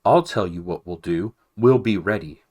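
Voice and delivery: loud, firm rallying call. A narrator's reading. Matthias rallies the frightened mice, loud and firm. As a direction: rouse the crowd with a loud promise.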